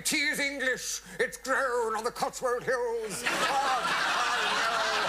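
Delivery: high, loud frantic improvising; desperate ad-lib. Retold or imitated. Actor improvising wildly when the blackout fails, high and loud. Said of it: "I was desperate".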